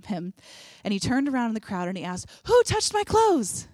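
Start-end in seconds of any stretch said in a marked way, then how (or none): 2.5-3.7 higher and louder, bursting with delight; giddy excitement. Retold or imitated. Jesus turning to the crowd, giddy and thrilled. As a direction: ask it like you can't wait to find out.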